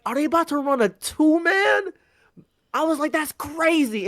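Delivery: high incredulous question; a high disbelieving gasp of a line; stunned disbelief. Retold or imitated. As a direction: ask it high, in shock. His own stunned reaction retold.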